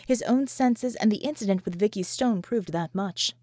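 usual speaking voice

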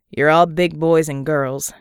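slow, loud captain on the intercom; gruff pilot. Acted. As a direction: bark it like a tough pilot.

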